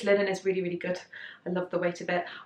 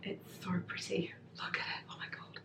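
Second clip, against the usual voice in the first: awed whisper; 12 dB quieter than usual. Real, not acted. Admiring fabric print.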